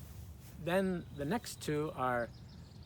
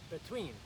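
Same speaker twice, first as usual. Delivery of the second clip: "Between" stressed on its second syllable, be-TWEEN; 5 semitones higher, 9 dB quieter than usual. Shown as a demonstration. Teacher models for learners, "Between" stressed on "tween". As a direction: lift the "tween" of "Between", then fall. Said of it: "accent is on the second syllable".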